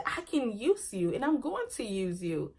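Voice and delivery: soft, sly aside to the slide; quietly plot, eyeing the slide; scheming to herself. Retold or imitated. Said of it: "I said".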